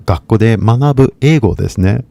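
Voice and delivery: usual speaking voice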